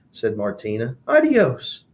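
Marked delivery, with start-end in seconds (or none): 1.1-1.8 dismissive. Acted. Martina dismisses lizard.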